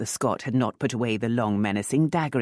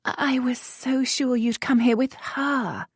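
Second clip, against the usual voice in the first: flighty Frenchwoman; 11 semitones higher than usual. Acted. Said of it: "She continued in French".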